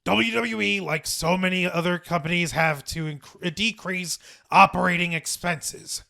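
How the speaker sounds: imitated company chairman addressing staff; put-on executive voice, measured and earnest; Vince McMahon. Shown as a demonstration. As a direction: deliver a formal statement as the impersonated boss. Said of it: "my best Vince McMahon voice".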